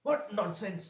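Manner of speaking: high scoffing what; indignant surprise. Shown as a demonstration. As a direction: throw it back in disbelief. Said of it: "इस type से अगर आप बोलते हैं".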